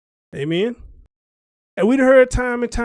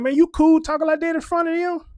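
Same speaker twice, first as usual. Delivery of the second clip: high, louder disbelieving question; incredulous retort; 9 semitones higher, 4 dB louder than usual. Retold or imitated. Retelling his question to young mothers, higher and incredulous. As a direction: ask it like you can't believe your ears. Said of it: "And I'm like".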